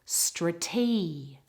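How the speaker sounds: "Strate" said struh-TEE, holding the E; long E vowel. Shown as a demonstration. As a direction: model "Strate" cut off after a long ee. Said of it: "The vowel in the middle is the long vowel E".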